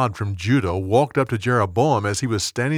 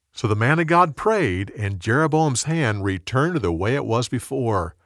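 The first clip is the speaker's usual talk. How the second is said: ordinary delivery, nothing marked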